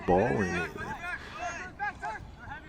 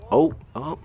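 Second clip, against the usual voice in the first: surprised; 8 semitones higher, 5 dB louder than usual. Real, not acted. Sudden play near goal.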